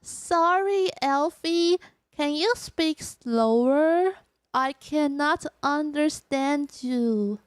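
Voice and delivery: very slow, deliberate English; careful, measured alien self-introduction; alien newcomer introducing herself. Pretend. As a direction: space out every word like a newcomer.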